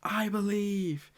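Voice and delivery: high, slow, held-back mock scream; imitated scream. Mister Two outside the operation room, restrained mock yell. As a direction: mimic a long yell without the volume. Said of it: "screaming at the top of his lungs".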